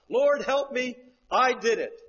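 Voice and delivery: high, loud plea; desperate cry for help. Retold or imitated. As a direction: cry out to God. Voicing sinner's cry.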